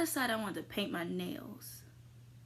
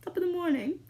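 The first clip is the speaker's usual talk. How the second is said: a playful Irish lilt, higher and louder; mock Irish greeting; 10 semitones higher, 5 dB louder, faster than usual. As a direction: toss it off in a cheery mock Irish accent. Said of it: "I just really love, like".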